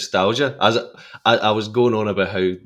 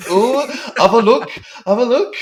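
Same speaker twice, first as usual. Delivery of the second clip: quoting Spike; 9 dB louder than usual. Retold or imitated. Fan re-enacting the doorstep bit for a friend, high loud mimicry. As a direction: call it out high and loud, mimicking a film character. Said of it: "he goes outside and he's like".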